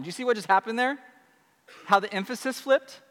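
ordinary talk

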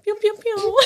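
very high cartoon pew-pews; silly echoed shooting noises; playful gunshot noises. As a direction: squeak out pretend laser fire.

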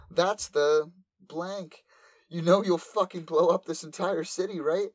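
put-on high, panicked anime girl; scared high voice. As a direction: plead it high and frightened. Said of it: "Nymph gets really scared".

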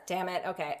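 ordinary talk, nothing marked

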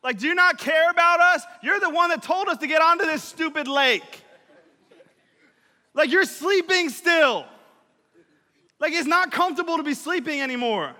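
loud, high, desperate yell. Retold or imitated. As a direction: scream at him, terrified. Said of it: "They're literally yelling at Jesus".

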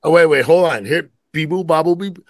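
normal talking voice

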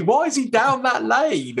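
high, drawn-out mock indignation; comic high-pitched plea; mock distress. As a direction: playfully protest on the poor boy's behalf. Mock outrage at nursery rhyme.

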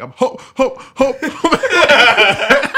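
laughing parody of his sister's tearful coming out; mock sobbing stammer. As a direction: stammer it like a sister choking on tears while cracking up. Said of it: "she says it while, like, sobbing".

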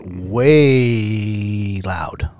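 WAY held extremely long; emphatic stretch. Real, not acted. Storyteller describing a roaring ATV, emphatic stretch. Stressing how loud the ATV was.

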